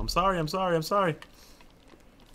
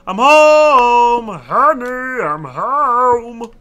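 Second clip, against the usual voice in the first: sing-song "Honey, I'm home" call, much louder and higher; sitcom homecoming call; 11 semitones higher, 17 dB louder, much slower than usual. Pretend. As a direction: announce yourself like a sitcom husband.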